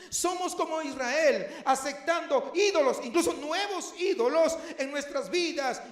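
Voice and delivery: loud, high fervent sermon delivery; impassioned pulpit cry; full-force preaching. Acted. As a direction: preach it hard to the congregation.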